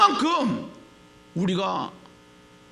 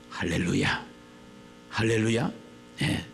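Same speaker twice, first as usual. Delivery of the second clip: ordinary talk, nothing marked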